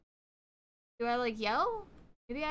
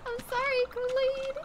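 high apology; 13 semitones higher than usual. Real, not acted. Apologizes to chatter Kool-Aid.

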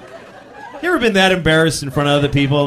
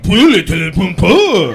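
mock French gibberish; 7 dB louder, slower than usual. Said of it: "his friend's like".

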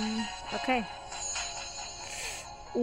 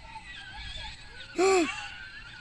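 high gasp with a laugh; 4 dB quieter than usual. Real, not acted.

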